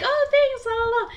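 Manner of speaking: mock polite brush-off. Thanking a stranger she didn't understand, high airy mock cheer. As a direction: chirp it as if you understood nothing.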